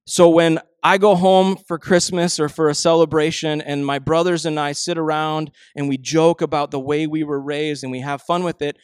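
normal talking voice

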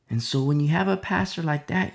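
ordinary delivery

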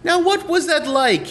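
high, slow TV interviewer impression; Barbara Walters. Interviewer questioning celebrities, high, slow mock voice. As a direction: draw the question out in a high put-on voice. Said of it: "She's like".

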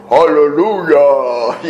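slow, booming imitation of a bass friend; mock-deep bass, drawn out slowly. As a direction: put on a big radio voice. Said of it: "he had this deep bass voice".

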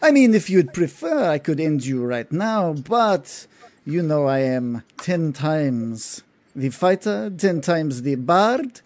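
a vain noble listing his superiority; boastful noble. Acted. Gindar continues boasting.

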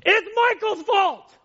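loud, slow, high pretend protest; mock shout. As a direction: yell the blame onto someone else, slowly. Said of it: "he could say".

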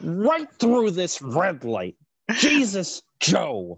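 high, slow, mock-furious impression; Joe Bernstein. Host imitates an angry driver exploding at a red light, ranting impression. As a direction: stretch it angrily, then burst out high. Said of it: "he did sound pretty angry".

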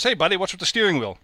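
a hint of a puzzled bartender asking; joke bartender. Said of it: "Bartender says".

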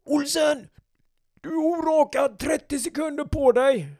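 high, loud drill captain barking at a recruit; yelling captain. Retold or imitated. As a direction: snap the order like an angry officer.

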